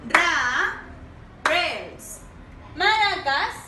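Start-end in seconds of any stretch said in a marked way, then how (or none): 0.1-2.2 "Ra-rails" in two drawn-out syllables with a pause between. Shown as a demonstration. Teacher modelling a word for pupils to repeat, "Ra-rails" broken into slow syllables. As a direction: say "Ra-rails" slowly, split after "Ra". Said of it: "Repeat after me".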